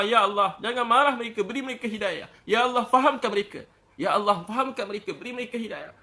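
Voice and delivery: high, pleading prayer, loud on the appeals; tearful prayer, imitated. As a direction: act out a fervent plea to God. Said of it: "Tengah malam nangis untuk umat". (meniru doa merayu sambil menangis)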